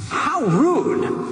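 high, mock-offended "how rude"; scandalized inner voice; indignant. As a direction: sniff at the rudeness. Thinking judgmental thought.

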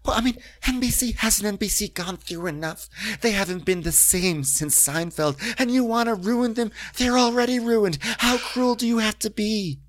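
high-pitched pleading indignation; mock outrage. Castmate accused of wanting to ruin NBC, mock outrage. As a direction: plead in hammed-up dismay.

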